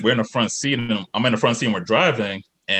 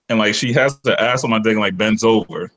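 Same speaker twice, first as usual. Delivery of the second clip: ordinary talk, nothing marked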